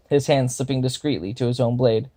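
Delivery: ordinary delivery, nothing marked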